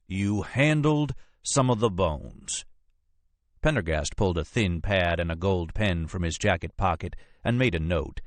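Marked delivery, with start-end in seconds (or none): 0.1-2.6 very slow, "handled" raised and stressed; slow accusation. Acted. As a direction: repeat it slowly, weighing "handled". Agent repeats it slowly, accusingly.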